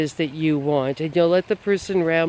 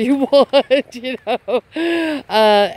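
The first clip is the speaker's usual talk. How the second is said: laughing; 6 semitones higher, 5 dB louder, slower than usual. Real, not acted. Laughs while mocking the trick.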